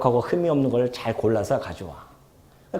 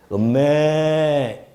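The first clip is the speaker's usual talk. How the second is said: drawn-out mooing like a grazing cow; much slower than usual. Retold or imitated. Preacher imitating a peaceful grazing cow, long slow moo. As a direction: stretch the moo out very slowly. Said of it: "소로 한번 합시다".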